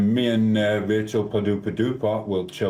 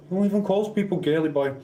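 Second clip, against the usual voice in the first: incredulous; 10 semitones higher than usual. Real, not acted. Mocking a chat message.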